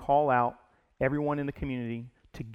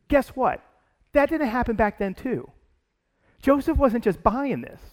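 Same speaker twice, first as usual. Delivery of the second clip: louder and higher, driving the point home; forceful preaching; 8 semitones higher, 6 dB louder than usual. Real, not acted. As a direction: preach it out with full force, raised pitch.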